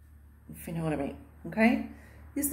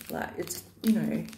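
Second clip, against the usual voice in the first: ordinary talk, nothing marked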